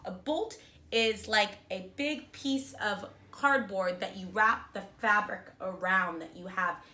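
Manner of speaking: ordinary delivery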